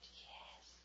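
a breathy little yes, barely voiced; timid whispered yes. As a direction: answer in a hushed whisper. Girl's reply, whispered.

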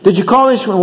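critical. Acted. Upset wife questioning husband about insurance, critical. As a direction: check up on him, critically. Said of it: "she criticizes him for something".

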